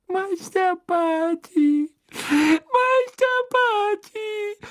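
sobbing high house-elf wail, slow; cartoon crybaby. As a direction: wail the name through tears. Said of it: "schluchzte die Hauselfe durch ihre Hände".

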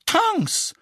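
high-pitched, appalled exclamation; horrified cry. A narrator's reading. Mrs Weasley seeing Tonks knock things over, horrified cry. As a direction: cry out the name in dismay. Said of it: "rief Misses Weasley entsetzt".

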